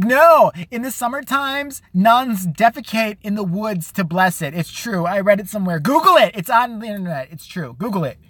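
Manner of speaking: imitating a friend's random fact with insistence; mock Teresa. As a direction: ham up a know-it-all friend swearing it's true. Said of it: "She comes up with weird random facts".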